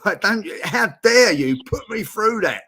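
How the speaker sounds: mock angry outburst. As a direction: replay the fury at his own side, half-joking. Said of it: "and I, I was angry".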